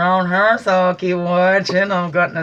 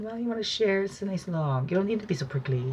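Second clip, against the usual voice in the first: higher, softer coaxing porcupine voice; sweet porcupine; 4 semitones higher, 7 dB quieter, faster than usual. Retold or imitated. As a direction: coax the bear gently, a little higher.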